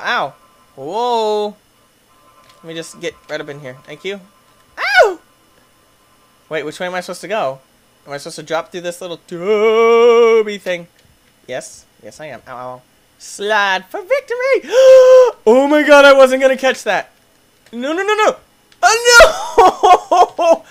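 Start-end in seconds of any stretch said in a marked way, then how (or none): 4.8-5.2 very high loud ow; sharp yelp. Real, not acted. Player reacting to a hit, high pained yelp. Rider gets hurt. 13.2-20.6 very high, loud laughing shouts; laughing screams. Real, not acted. Player sliding wildly, high laughing screams. Wild slide and near fall.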